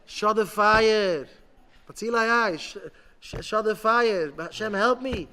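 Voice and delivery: loud, drawn-out plea; screaming to Hashem. Acted. A person begging Hashem to put out the fire, screaming. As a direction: cry out for help at full voice. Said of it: "to scream to Hashem".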